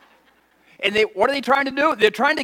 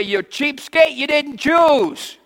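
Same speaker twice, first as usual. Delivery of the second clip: high, loud mock rant; angry vendor; 7 semitones higher, 5 dB louder than usual. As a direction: yell like a starving shopkeeper. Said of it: "They say".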